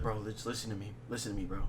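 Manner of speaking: low, soft urging; hushed insistence. Retold or imitated. Drunk homie urging his friend to listen, hushed. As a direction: keep it low and pressing.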